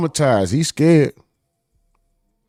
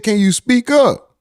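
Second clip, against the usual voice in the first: mock courtroom question; 8 semitones higher, 7 dB louder than usual. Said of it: "they said".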